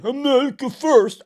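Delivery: loud high mocking "America First". Shown as a demonstration. Streamer demonstrates his mocking groyper way of saying it. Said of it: "I'm talking about groypers".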